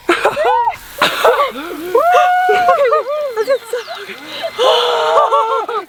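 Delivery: loud high laughing scream; shrieking laugh. Real, not acted. Squirts partner with water.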